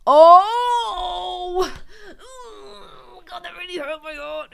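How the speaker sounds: high, teary outburst; crying out. Real, not acted. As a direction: let the hurt burst out in a cry. Overwhelmed by the film's sad moment.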